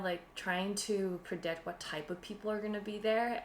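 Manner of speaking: usual speaking voice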